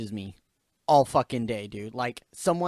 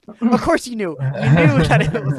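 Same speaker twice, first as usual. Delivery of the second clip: mock exasperation; 12 dB louder than usual. Retold mocking retort to know-it-all coworker.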